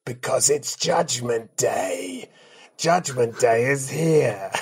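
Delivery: slow, booming host announcing the afterlife; a grand gatekeeper between heaven and hell, drawn out; Judgment Day host. Acted. Podcast host greets guest as Judgment Day judge, booming and slow.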